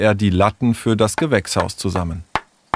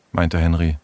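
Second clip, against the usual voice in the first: ordinary delivery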